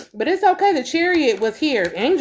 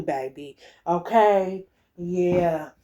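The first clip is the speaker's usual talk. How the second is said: ordinary delivery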